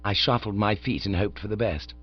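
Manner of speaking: usual speaking voice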